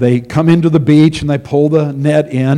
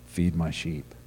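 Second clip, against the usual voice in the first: much softer and lower, almost under the breath; softly and lower, Jesus giving Peter his final charge; hushed charge; 10 semitones lower, 16 dB quieter, slower than usual. Retold or imitated. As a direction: let it sink down to a hush.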